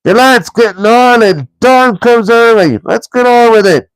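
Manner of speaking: high, loud troll urging. A narrator's reading. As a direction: hurry them along like a troll. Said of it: "said Tom and Bert together".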